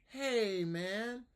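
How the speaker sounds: student copies; small student. Shown as a demonstration. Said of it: "I'll be a small student".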